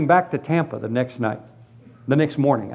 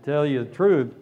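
normal talking voice

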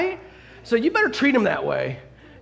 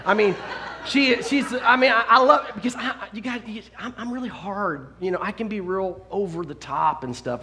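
ordinary delivery

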